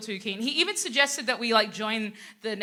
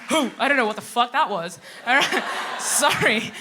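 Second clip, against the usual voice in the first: ordinary talk, nothing marked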